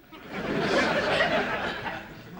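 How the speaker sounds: breathy high giggle; wheezy giggle. Acted. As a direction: wheeze with naughty delight. Laughs at own innuendo.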